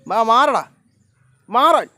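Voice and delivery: loud, high yell to shoo them off the road; shouting at the animals. Real, not acted. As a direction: bark it out so they move. (മൃഗങ്ങളെ റോഡിൽ നിന്ന് ഓടിക്കാൻ ഉച്ചത്തിൽ അലറുന്നു)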